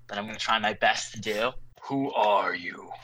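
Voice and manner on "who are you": slow, low gravelly stranger. Acted. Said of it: "in a low, gravelly voice".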